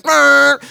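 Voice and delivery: loud, high honk made by mouth; imitated taxi horn. Taxi cab behind the protesters, imitated horn blare. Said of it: "There's a fucking taxi cab behind us".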